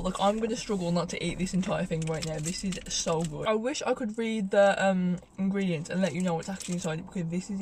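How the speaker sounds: talking between bites of chocolate; chewing while speaking; mouth partly full. Real, not acted. Seen 2: Holds bitten bar throughout; bites it at 7.2, likely chewing after.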